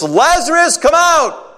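high, loud commanding call. Shown as a demonstration. Jesus calling dead Lazarus from the grave, loud high shout. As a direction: shout it so the dead can hear. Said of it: "And he does it loudly".

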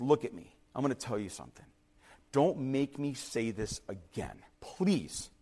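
low, quiet and deliberate; hushed, measured last warning; patient warning. Retold or imitated. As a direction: hold back before the big declaration. Castaway warns his friend one last time.

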